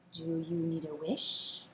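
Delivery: put-on low, slow and hushed old wizard; soft, deep wizard asking the bullfrog. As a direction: speak like a mysterious wizard.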